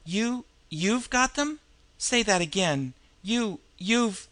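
high, halting, astonished questioning; incredulous stammer. A narrator's reading. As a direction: stumble over the words in disbelief. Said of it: "he cried out incredulously".

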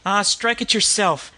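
high, tough slum retort. A narrator's reading. Disguised woman snaps back at the detective in slum voice. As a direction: shoot it back rudely. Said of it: "retorted Rhoda Gray".

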